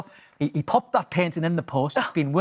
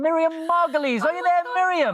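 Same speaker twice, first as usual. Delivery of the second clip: higher, sing-song call; 10 semitones higher than usual. Real, not acted. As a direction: call her name across the line. Calling out to a satellite guest.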